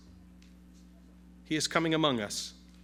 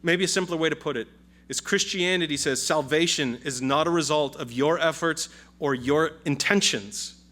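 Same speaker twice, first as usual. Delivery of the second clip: usual speaking voice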